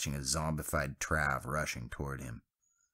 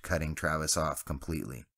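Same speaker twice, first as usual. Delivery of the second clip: usual speaking voice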